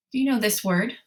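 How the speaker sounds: ordinary talk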